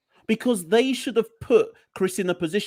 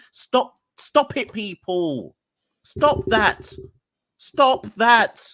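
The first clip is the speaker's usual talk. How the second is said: real rant, higher and building louder; worked-up exasperation; 6 semitones higher, 4 dB louder, much slower than usual. Real, not acted. Pundit telling fans to stop hyping players, exasperated. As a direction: scold in separate bursts, slow, high and increasingly loud. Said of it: "I'm going up on one".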